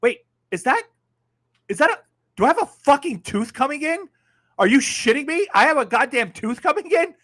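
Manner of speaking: shocked disbelief. Retold or imitated. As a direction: pitch flies way up in retold shock. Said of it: "and I'm like".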